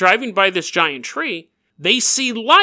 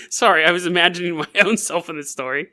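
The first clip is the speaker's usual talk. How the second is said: normal talking voice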